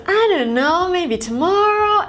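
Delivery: high-pitched "I don't know, maybe tomorrow", ends lifted; rising intonation. Shown as a demonstration. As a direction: swoop "know" and "tomorrow" upward. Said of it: "again, goes up".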